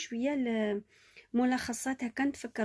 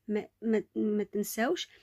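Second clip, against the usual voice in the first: usual speaking voice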